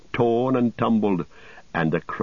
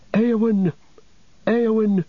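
high, loud desperate cry; 14 semitones higher, 4 dB louder than usual. A narrator's reading. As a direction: call out her name in anguish. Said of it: "cried Merry".

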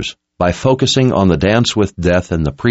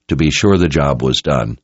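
plain reading voice

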